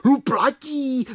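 high, excited exclamation; keen, high-pitched bumblebee cheer; enthusiastic cry. Acted. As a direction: cry out the agreement eagerly, drawn out. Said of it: "vykřikl nadšeně Čmelda".

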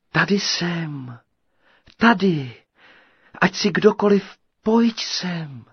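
high, sweet and loud, calling out. A narrator's reading. Enchanted voice calling the tailor into the cave, sweet and high. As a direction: lure him in with a sweet high call. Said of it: "volal sladký hlas".

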